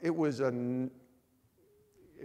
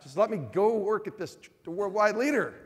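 excited plea; 7 semitones higher, 7 dB louder than usual. Retold or imitated. Pleading with wife about ESPN job.

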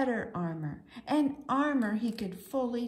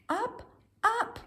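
each up climbs higher in pitch; rising up; 12 semitones higher than usual. A narrator's reading. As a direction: lift the voice with each up. Being lifted higher.

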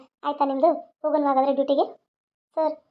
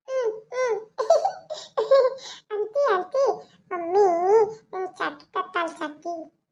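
high put-on toddler voice with giggles; giggling little girl; 6 semitones higher, slower than usual. Child telling auntie about promised chocolate, high giggly baby talk. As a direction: babble happily like a small child. Child chats happily with the maid.

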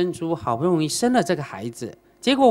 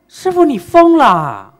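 slow, loud and higher, aghast; shocked outcry; 8 semitones higher, 11 dB louder, slower than usual. Retold or imitated. Father hears the master order him to throw the body to the fish, shocked outcry. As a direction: cry out in disbelief.

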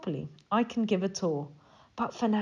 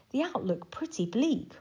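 ordinary delivery

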